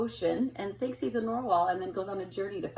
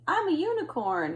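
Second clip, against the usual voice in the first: rhino claiming unicorn; 7 semitones higher, 5 dB louder than usual. Acted. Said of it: "this rhinoceros is saying".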